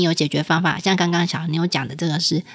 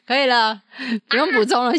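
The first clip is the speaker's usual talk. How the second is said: normal talking voice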